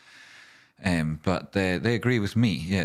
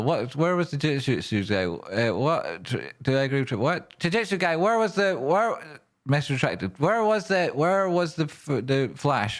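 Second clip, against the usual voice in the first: much higher, hurried, calling out; frantic search; 7 semitones higher than usual. Real, not acted. Streamer hunting the Jujutsu guy's message, high and urgent. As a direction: scan chat eagerly for the message.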